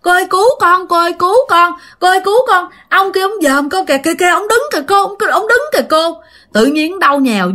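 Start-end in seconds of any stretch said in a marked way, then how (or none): 0.0-6.2 higher, panicked cry for help; frightened young man crying for help; terrified calling out. Retold or imitated. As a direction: plead in terror, much higher and rushed. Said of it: "ảnh vừa la làng".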